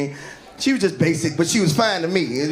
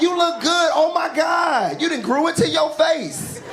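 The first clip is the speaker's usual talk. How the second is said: excited gushing; 7 semitones higher, slower than usual. Retold or imitated. Crush gushes over his face, mock excited. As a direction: gush over him, a little overdone.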